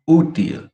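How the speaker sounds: Útil with Ú pitched high; stress on first syllable. Shown as a demonstration. Teacher repeating the contrast, first-syllable stress on Útil. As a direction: hit the Ú of Útil high, then fall. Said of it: "I emphasize on the first word".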